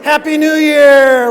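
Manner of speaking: slow, loud festive shout; New Year's shout. Pretend. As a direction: cheer it out like at midnight. Mock New Year's cheer.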